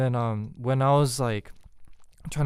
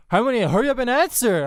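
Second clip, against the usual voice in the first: fast and much higher, pushy; pushy teasing; 10 semitones higher, 4 dB louder, much faster than usual. Retold or imitated. Boyfriend pressing grandma to answer, quick and high. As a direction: hurry grandma cheekily. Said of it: "And he goes like".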